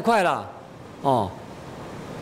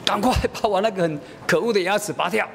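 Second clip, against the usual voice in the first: higher-pitched imitation of a determined little girl; insistent child; 5 semitones higher than usual. Daughter ordering father to pull the tooth, insistent child. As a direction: stubbornly demand it in a child's voice. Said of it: "但是他很坚决".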